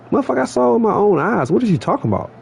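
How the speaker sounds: rising indignant retort, replaying the argument; retold outrage. Retelling confronting the supervisor.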